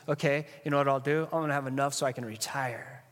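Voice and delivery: quick, rattling off the plan; hasty planning. Retold or imitated. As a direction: rush through it like a busy schemer. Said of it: "what I see is this".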